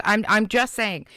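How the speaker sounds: ordinary talk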